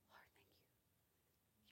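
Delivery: slow, soft whispered thanks; whispered prayer in worship. Real, not acted. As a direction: breathe it to God.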